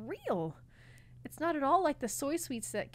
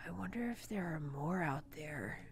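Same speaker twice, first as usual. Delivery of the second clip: whispering; 4 semitones lower, 3 dB quieter than usual. Acted. Dis speaks dreamily.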